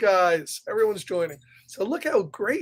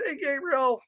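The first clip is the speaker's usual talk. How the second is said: plain reading voice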